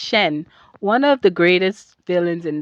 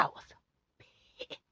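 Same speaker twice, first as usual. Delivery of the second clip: voiceless whisper on the word; barely audible, hushed outrage; whispered anger; 25 dB quieter than usual. Retold or imitated. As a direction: drop to a bare, seething whisper. Stressing how angry she was.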